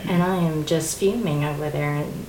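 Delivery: normal talking voice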